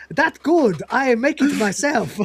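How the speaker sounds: proud vendor in a put-on Chinese accent; mock Chinese accent. Vending machine boasts to the cat in mock Chinese accent.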